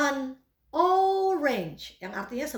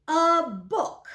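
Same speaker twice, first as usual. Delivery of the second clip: A book said clearly and a little louder; model phrase for repeating; 3 semitones higher, 4 dB louder than usual. Shown as a demonstration. Teacher says A book for students to repeat. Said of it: "Mari ikuti saya".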